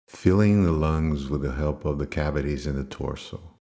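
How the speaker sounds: low, unhurried meditation voice with long pauses; guided meditation. Acted. Teacher leading listeners into controlled breathing, guided meditation voice. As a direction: calmly guide them into the breath, pitched low.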